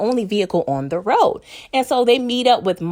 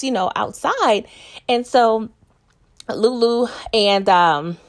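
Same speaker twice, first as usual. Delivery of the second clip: ordinary talk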